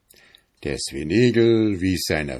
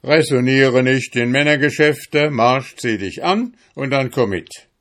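loud, bossy order; commanding husband; 5 semitones higher, 5 dB louder than usual. Acted. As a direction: drive her out the door. Hedgehog orders wife out. (herrischer Befehl, laut)

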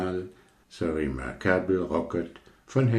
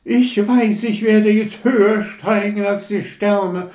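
prahlerische Rakete; 8 dB louder than usual. Acted. Rakete prahlt beim Aufsteigen.

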